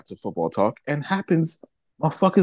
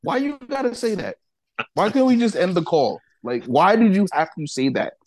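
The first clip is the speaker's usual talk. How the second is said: higher-pitched mock complaint; whiny retold outrage, pitched up; indignant rant; 7 semitones higher than usual. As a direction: replay the outraged complaint to the fan. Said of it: "I'm like".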